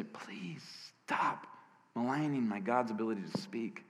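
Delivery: soft, weary appeal; hushed plea. Acted. Preacher begging critics to stop, hushed plea. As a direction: plead quietly, well under full voice.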